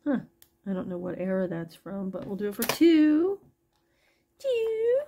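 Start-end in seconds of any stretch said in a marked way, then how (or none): 4.4-5.0 much higher, sung-out price; playful price call. Real, not acted. Seller announcing a two dollar price, playful high call. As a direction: chirp the price brightly.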